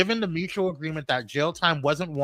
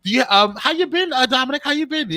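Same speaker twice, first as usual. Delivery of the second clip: excited; 10 semitones higher, 7 dB louder than usual. Real, not acted. Excited greeting of co-host.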